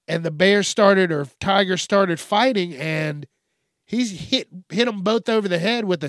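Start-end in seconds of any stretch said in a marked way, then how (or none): none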